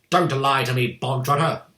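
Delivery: loud, sharp bark. A narrator's reading. Headmistress calling the boy a liar, barking. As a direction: bark the command at him. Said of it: "barked the Trunchbull".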